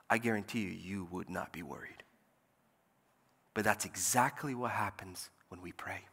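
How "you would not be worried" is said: ordinary talk, nothing marked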